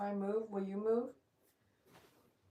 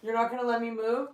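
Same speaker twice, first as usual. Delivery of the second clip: loud playful protest; 2 semitones higher, 10 dB louder, faster than usual. Real, not acted. Dog pinning her down.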